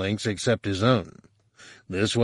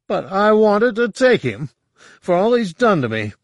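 upset outburst; 10 semitones higher, 4 dB louder than usual. Acted. Han protests being cheated.

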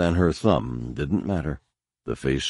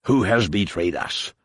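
repeated hissed threat. A narrator's reading. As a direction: press him once more, menacing. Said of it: "Shun Mai's attacker repeated".

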